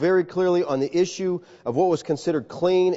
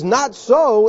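louder "Not so"; firm refusal; 5 semitones higher, 6 dB louder than usual. Acted. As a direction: push back hard. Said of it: "And Peter says".

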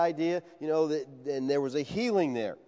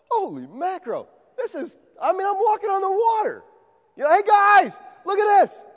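much higher, building to a shout of wonder; excited Peter; 6 dB louder than usual. Acted. Peter on the water shouting to the disciples, thrilled and loud. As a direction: call to the guys in amazed delight. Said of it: "he's like".

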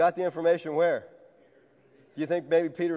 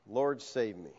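soft, lower whispered-down prayer; quiet plea; 7 semitones lower, 8 dB quieter, slower than usual. Acted. Preacher closing the story, Peter's plea said soft and low. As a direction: let it fall soft and low.

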